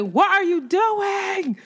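high, slow shriek stretched out; screaming at the cat. Retold or imitated. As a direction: yell it at the cat caught on the stove. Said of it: "I started screaming at her".

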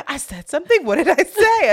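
high, loud retold questioning; surprised curiosity. Imitating a friend asking what she said, surprised. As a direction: imitate someone suddenly eager to know.

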